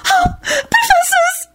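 very high, slow, sobbing cry; frantic wail. Acted. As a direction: wail for rescue. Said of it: "Serena wailed frantically".

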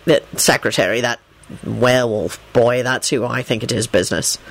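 low, calm official voice; a hint of a stern Auror; Kingsley's voice. A narrator's reading.

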